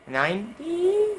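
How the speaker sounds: high, drawn-out disbelieving question; baffled, high-pitched query; incredulous. Retold or imitated. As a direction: stretch it slowly up into a squeak of disbelief. Teller acts out his baffled reply.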